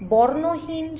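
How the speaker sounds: ordinary delivery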